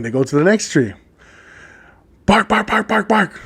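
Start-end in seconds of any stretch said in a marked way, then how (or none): 2.2-3.4 higher, quiet yapping bark; barking dogs. Acted. Joke teller playing police dogs at a tree, barking. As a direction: imitate dogs with quick repeated barks. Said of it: "the dogs are barking".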